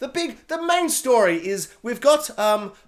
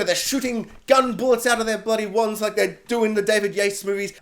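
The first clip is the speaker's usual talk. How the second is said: ordinary delivery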